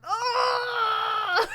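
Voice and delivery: high, drawn-out "aah" wail; mock wail. Fan reacting to the story, high mock wail.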